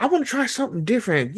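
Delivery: quick high cartoon quote; cartoon character voice. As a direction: toss it off like a cartoon musician.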